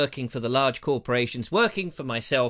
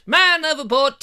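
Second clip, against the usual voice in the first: higher, louder quick alarm cry; man overboard shout; 9 semitones higher, 7 dB louder than usual. Pretend. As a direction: shout it out rising. Repeated comic cry.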